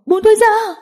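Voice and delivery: very high, forceful female shout; desperate high-pitched yell; struggling, frantic cry. Acted. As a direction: scream to be released, escalating. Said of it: "Diệu Tinh giãy giụa".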